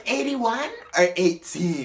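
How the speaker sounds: put-on drunk confusion. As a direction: repeat it sincerely. Imitating drunk confused question.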